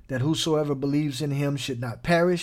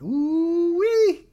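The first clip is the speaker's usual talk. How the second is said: high, held, louder ooh-wee; ooh-wee exclamation; 14 semitones higher, 4 dB louder than usual. Real, not acted. Preacher reacting to a quoted verse, high and amazed. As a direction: marvel at it, much higher.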